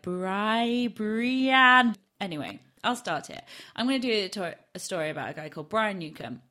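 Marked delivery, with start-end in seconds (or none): 0.0-1.9 sounding it out. Real, not acted. Sounding out a word from notes.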